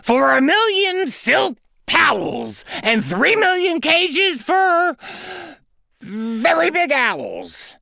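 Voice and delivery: the greedy duck at full frenzy; frenzied greedy duck. Acted. Duck's frenzied wishing.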